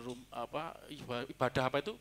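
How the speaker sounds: usual speaking voice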